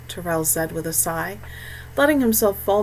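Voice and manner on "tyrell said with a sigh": usual speaking voice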